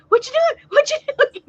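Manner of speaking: very high squawking parrot imitation. Drive-through staff mimicking the parrot, very high squawk. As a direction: screech it like the parrot does. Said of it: "And they said".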